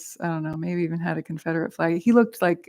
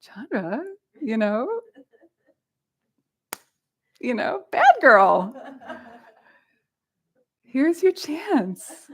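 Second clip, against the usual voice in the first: slow, higher teasing devil voice; tempting inner voice; 7 semitones higher, slower than usual. Retold or imitated. A mischievous inner voice egging her on, slow and teasing. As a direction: tempt yourself playfully. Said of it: "What I thought is".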